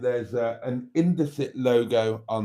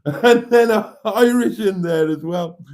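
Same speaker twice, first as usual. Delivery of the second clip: laughing; 7 semitones higher, 6 dB louder than usual. Real, not acted. Amused listing of accents.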